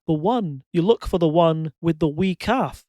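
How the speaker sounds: punchline in a put-on Scottish accent; joke-telling Scottish accent. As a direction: deliver the pun in Scottish. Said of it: "do a Scottish accent for this one".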